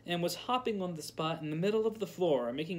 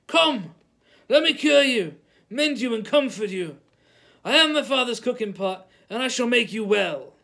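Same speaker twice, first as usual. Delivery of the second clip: crying out to the village; 7 semitones higher, 10 dB louder than usual. A narrator's reading. Wizard shouting to the village.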